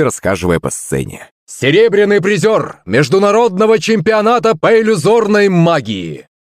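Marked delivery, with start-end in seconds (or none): 1.5-6.3 high, grand stage introduction; excited announcer presenting a star performer; showman host. Acted. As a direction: build up the next act for the crowd. Host introduces the next illusionist.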